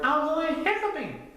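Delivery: high-pitched little cub; high storybook cub; Little Bear character voice. A narrator's reading. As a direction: answer high and small like a young bear.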